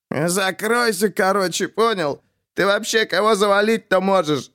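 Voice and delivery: high, spiteful retort; a sneering high jab at the rival; spiteful snap. A narrator's reading. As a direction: snap back to wound him. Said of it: "Мстительно огрызнулся Жарков".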